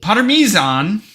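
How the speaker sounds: Parmesan drawn out loud and high as "par-mee-ZAHN"; exaggerated mispronunciation. Shown as a demonstration. Host goofing on the pronunciation question, exaggerated loud Parmesan. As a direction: stretch Parmesan slowly, "mee" then a long "zahn". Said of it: "How do you pronounce Parmesan".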